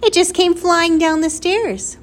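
high, loud exclamation; shouted. A narrator's reading. Flora's mother tells how the squirrel arrived, shouting. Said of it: "shouted Flora's mother".

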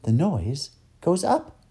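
high, slow voice of discovery; surprised. Acted. A girl finding that the noise goes up, surprised. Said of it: "Her face registered surprise".

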